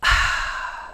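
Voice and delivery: long breathy sigh out; careful exhale. A narrator's reading. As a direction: let held breath out carefully. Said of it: "they carefully exhaled".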